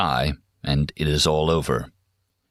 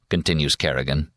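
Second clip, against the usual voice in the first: plain reading voice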